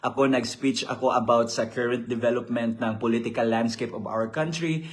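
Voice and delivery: normal talking voice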